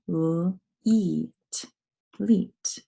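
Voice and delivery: l, eat, leet blended slowly sound by sound; sounding out a syllable. Shown as a demonstration. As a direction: segment then blend leet. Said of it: "Second syllable".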